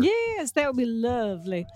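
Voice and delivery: put-on lovely in a Southern belle accent. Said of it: "a little bit of a Tennessee Williams thing".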